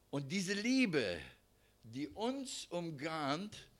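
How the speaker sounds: drawn out, lingering on each word; very slow emphasis. Acted. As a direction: linger over the love. Preacher slows for emphasis.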